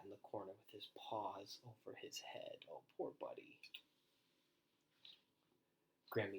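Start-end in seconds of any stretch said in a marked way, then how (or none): 2.7-3.6 tender aside. Real, not acted. Reader aside, sympathy for dog.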